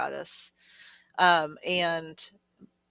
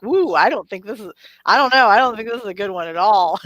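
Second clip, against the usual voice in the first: loud, higher-pitched imitation of a bluffing card reader; mock tarot reader; 3 semitones higher, 10 dB louder than usual. Teasing a friend's made-up tarot reading, mock card-reader voice. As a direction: play up a made-up reading, loudly. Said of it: "making shit up, and you're like".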